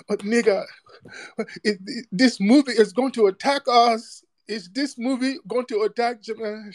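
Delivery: high-pitched put-on voice of an outraged critic; mock their fuss in a high voice; mock whining complainer. Host imitating upset critics of the trailer, mock high whine.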